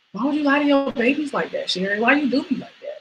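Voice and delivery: a hint of an indignant mother; mock mother scolding. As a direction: play the hurt mom, half-joking. Mother voiced scolding Sherry.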